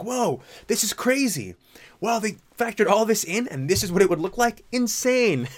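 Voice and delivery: high, awed mock amazement; amazed. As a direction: gush like it blew your mind. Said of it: "I'm like".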